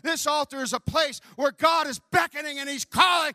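loud, high revival shouting; preaching at full force. Acted. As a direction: whip the congregation up with fire. Altar call preaching.

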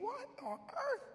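a squeak of disbelief; baffled disbelief. Retold or imitated. Preacher in his dream seeing an old runner, high baffled squeak.

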